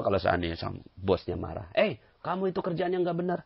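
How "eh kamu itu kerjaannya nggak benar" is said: scolding boss. Retold or imitated.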